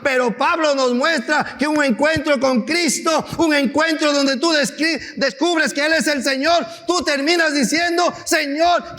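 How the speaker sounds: high, loud, impassioned sermon build; preaching at full force. Acted. Pastor rousing the congregation, impassioned loud preaching. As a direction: preach it, rising and pressing the congregation.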